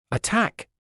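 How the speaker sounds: shouting. Acted. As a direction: yell the order to attack. Battle command called out.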